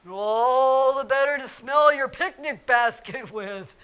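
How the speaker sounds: a wolf badly pretending to be ill; wolf faking grandma. Wolf in bed pretends to be sick grandma.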